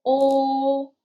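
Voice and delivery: o held long and level, starting loud; final sound o. Shown as a demonstration. Teacher isolates the final of 我, sounded out. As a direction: hold o steady on one note. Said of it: "and final".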